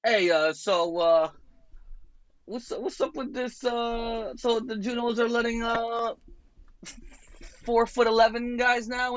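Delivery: a ribbing comedian's high falsetto jab; mocking impression. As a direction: needle him in a squeaky high mock voice. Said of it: "he would always be like".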